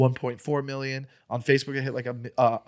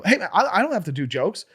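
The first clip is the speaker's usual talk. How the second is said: retold heated argument; 6 semitones higher, 4 dB louder than usual. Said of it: "we were just going in".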